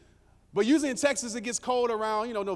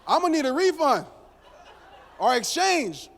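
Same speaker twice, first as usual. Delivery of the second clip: laughing while lying; 4 semitones higher, 7 dB louder than usual. Retold or imitated. Asking for a refund.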